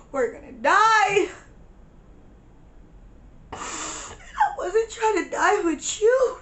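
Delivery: high, tearful wail; acted crying. Panicking at friend about dying, acted crying. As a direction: whine it through mock tears. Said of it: "I broke down and I cried".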